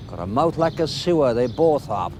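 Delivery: normal talking voice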